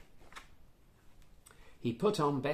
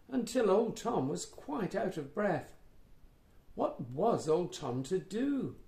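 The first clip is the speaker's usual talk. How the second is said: usual speaking voice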